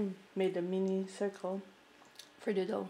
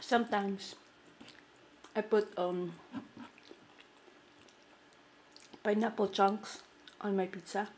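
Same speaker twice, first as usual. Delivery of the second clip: speaking with food in mouth; chewing between words; talking while chewing. Real, not acted. Seen 3: Took bite at -1.0; lips pressed, jaw working between phrases.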